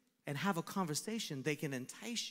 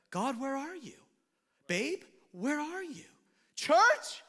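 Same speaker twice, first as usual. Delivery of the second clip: mock innocent questioning; 9 semitones higher, 7 dB louder, slower than usual. Congregant pleading questions to God.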